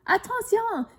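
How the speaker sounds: high, loud warning call; shouting a warning. A narrator's reading. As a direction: yell to get everyone clear. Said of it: "crie un mécanicien".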